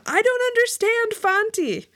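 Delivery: high, insistent denial; crying out. A narrator's reading. Customer feigning ignorance to dodge a suitor, crying out. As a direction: insist in high-pitched mock despair. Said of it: "I cried again".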